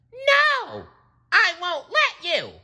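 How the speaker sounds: very high squeaky defiance; squeaky brave sponge. A narrator's reading. SpongeBob defending his boss, squeaky and high. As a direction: stand up for your boss. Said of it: "said SpongeBob".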